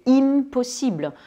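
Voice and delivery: "impossible" in French with "im" said as "inn"; wrongly sounded N/M. Shown as a demonstration. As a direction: break the nasal in "impossible" and sound the consonant. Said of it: "je vais pas le prononcer". (« impossible » avec le M prononcé, « inn-possible »)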